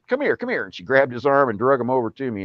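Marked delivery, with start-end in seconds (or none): 0.1-0.6 much higher, eager come-here call; imitating the aunt's excited summons; aunt calling excitedly. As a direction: pull him over, voice up high. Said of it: "And she said".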